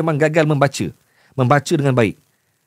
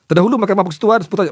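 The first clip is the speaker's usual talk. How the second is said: fast, higher reading that breaks down; stuck while reading; 6 semitones higher, faster than usual. Real, not acted. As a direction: rush into the news line and jam mid-word. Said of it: "nampak, stuck".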